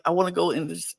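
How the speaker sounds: ordinary talk, nothing marked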